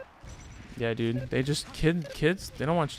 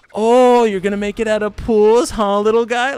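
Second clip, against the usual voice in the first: put-on high, loud condescending tease to a kid; mock patronizing voice, pitched way up and loud; talking down; 9 semitones higher, 11 dB louder than usual. To a teen player he met, a mock condescending taunt. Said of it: "talking down to the kid".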